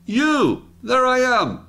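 high, delighted cry at spotting yourself; mock-thrilled schoolkid finding his own face; excited self-discovery. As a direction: point yourself out in the photo with glee. Pretends to spot himself in photo.

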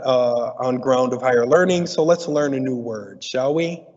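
ordinary talk, nothing marked

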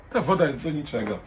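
plain reading voice

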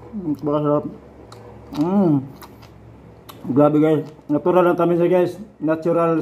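chewing while talking; speaking between bites; mouth full of fruit. Real, not acted. Seen 1: Holds bitten papaya, cheeks full, chewing; bites again at 2.6-2.9.

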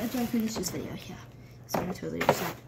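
whispered promise to upload the videos; whispered aside. Real, not acted. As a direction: whisper it unvoiced as a sly aside. Whispered repeat of previous line.

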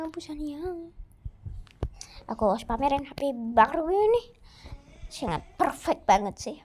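ordinary talk

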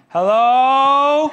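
a loud, high, half-laughing "Hello?"; helpless call. Retold or imitated. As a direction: call out from the roof for help, half joking. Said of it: "someone going".

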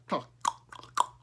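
clicking tongue to start horses; dry voiceless clucking; tongue clucks. A narrator's reading. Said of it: "He gave a kind of cluck".

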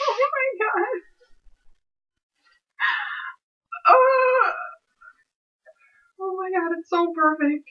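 very high, overwhelmed near-crying squeal; tearful joy. Real, not acted. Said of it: "I'm gonna cry".

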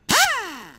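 an exasperated shriek of a laugh; high squealing laugh; high burst of laughter. Acted. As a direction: laugh shoots up high and loud. Servant laughs at his master.

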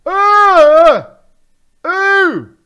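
loud, high exclaiming in disbelief; excited shouting. Real, not acted. Shocked discovery of key bindings.

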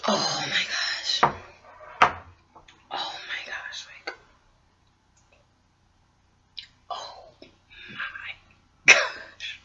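awed whisper; hushed in disbelief. Real, not acted. Awed over spicy noodles.

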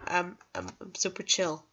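ordinary talk

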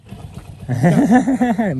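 high, loud burst of laughter; loud real laugh. Real, not acted. Vlogger laughs with the man after the joke, loud real laugh. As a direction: crack up openly. Joking with Anna about cow.

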